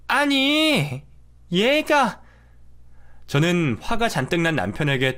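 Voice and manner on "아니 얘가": put-on much higher, flustered old woman; high, offended half-sentence; mother-in-law. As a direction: sputter at her son in disbelief.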